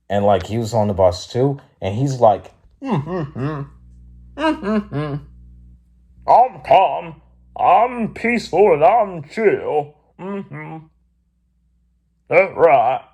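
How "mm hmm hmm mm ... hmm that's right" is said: high, put-on old man's voice; old man impression. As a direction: hum to yourself, insisting on being calm.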